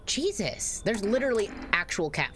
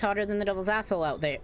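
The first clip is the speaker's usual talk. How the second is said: put-on southern accent, read fast; 2 semitones higher, faster than usual. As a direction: lean into a Southern US accent, a bit quicker. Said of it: "This is definitely a bit more southern".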